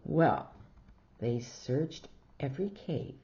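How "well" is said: plain reading voice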